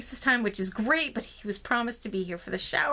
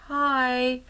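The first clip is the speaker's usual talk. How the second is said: a small, subdued hi from the worried friend; friend's tiny hi; 4 semitones higher, 3 dB louder than usual. Retold or imitated. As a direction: play the friend's timid greeting on the phone. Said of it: "Okay, that was her".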